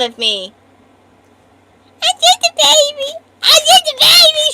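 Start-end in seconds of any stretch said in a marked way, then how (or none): 2.0-4.5 high, whiny fake-sobbing baby voice; mock them like a wailing toddler; hammed-up baby whimper; mock crying baby. Mocking chat in baby voice.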